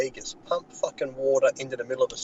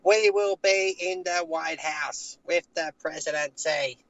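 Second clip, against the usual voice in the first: slow, higher, mock-shouted campaign line; mock Hillary yelling; 4 semitones higher, slower than usual. Mocking a politician who yells her speeches. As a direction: ham up a stiff, yelled stump-speech voice. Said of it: "she's yelling like this when she talks".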